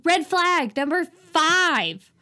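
loud, high, drawn-out announcement; mock alarm call. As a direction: call it out slowly like a warning siren. Calling out a movie red flag.

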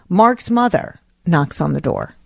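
normal talking voice

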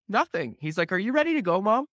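put-on high little-kid voice; imitated innocent child. Five-year-old hiding something from his mother, imitated innocence. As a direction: play a small boy acting innocent.

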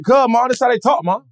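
put-on high-pitched kid copying classmates' country accent; picked-up country accent. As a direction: plead with mom in borrowed country speech. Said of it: "I start sounding like them".

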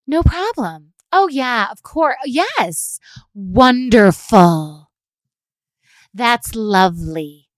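kill them with politeness; gushing fake delight, drawing out wonderful; passive-aggressive sweetness. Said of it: "Pass Ag 'em".

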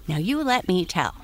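usual speaking voice

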